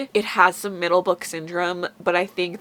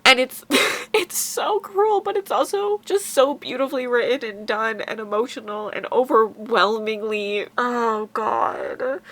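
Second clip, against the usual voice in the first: tearful laugh-cry, voice pitched up and straining; slow, overwhelmed, choking on emotion; 4 semitones higher than usual. Real, not acted. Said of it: "I need to stop crying".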